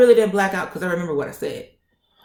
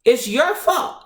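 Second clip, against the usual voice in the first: raised, higher-pitched blame; loud accusation; 7 semitones higher, 3 dB louder, slower than usual. Retold or imitated. Patient blaming the nurse, getting loud. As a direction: throw the blame at them, worked up. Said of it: "I got loud".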